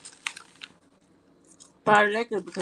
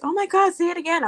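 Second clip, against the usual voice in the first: imitated thrilled squeal, high and loud; mock gushing, pitch leaping up; excited admirer; 11 semitones higher, 6 dB louder than usual. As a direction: beg for it like a delighted fan. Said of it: "people were like".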